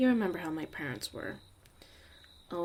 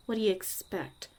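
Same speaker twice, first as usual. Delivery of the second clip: ordinary talk, nothing marked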